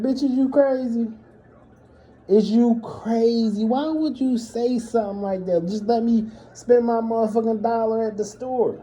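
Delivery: high, incredulous scolding; exasperated confrontation. Retold or imitated. Son confronting his mom after the store, high exasperated questions. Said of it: "I'm looking at her like".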